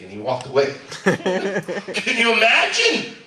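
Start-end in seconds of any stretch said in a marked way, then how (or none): 1.0-3.1 laughing. Real, not acted. Laughs at absurd picture.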